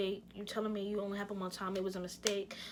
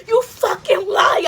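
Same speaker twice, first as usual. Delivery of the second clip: furious high-pitched shout, words drawn out; spazzing; 12 semitones higher, 19 dB louder, slower than usual. Retold or imitated. Girlfriend catching her man in a lie, angry shout. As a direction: scream it at full volume, high and slow. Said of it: "I started spazzing, yo".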